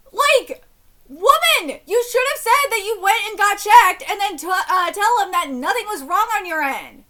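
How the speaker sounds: lecturing the wife in the story; angry scolding. Real, not acted. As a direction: lay into her, exasperated.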